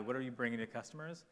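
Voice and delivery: ordinary talk, nothing marked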